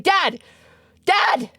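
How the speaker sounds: put-on high, loud excited child's shouting; imitating kid yelling. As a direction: call for dad like a thrilled little kid. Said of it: "he was yelling for Zolon".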